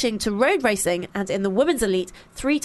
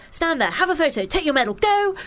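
higher, brisk conveyor-belt orders; imitating a hurrying official; mock marathon marshal; 6 semitones higher than usual. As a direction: herd runners along in short commands. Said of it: "churned along in a conveyor belt, like".

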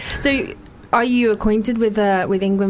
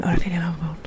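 savoring whisper; hushed, relishing whisper of the name; 4 semitones lower, 4 dB quieter, faster than usual. Acted. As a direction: breathe the name lovingly. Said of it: "don't you just love saying that name".

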